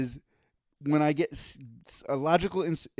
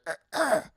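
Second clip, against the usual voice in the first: guttural grunt; 10 semitones higher than usual. Retold or imitated. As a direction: grunt it more manly and guttural. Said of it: "more guttural and manly".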